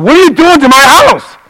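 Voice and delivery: high, loud scolding cry; mock yell. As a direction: call out like an indignant homeowner. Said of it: "the Holy Spirit yelled".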